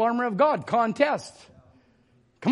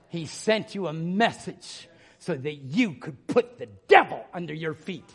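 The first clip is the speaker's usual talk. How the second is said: ordinary talk, nothing marked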